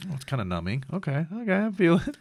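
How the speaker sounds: lightly acted, pitch climbing as it registers; tentative patient. Patient feeling his numb chest, tentative. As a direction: play a slightly dazed patient checking. Acting himself noticing numbness after surgery.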